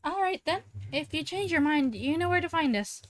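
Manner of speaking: Ashley voice. Acted. Voicing Ashley again.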